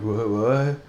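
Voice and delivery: stoned dude defending his pot; stoner voice. Retold or imitated. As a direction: play an offended pothead, bro. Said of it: "all the stoners out there are like".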